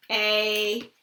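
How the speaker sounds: ordinary delivery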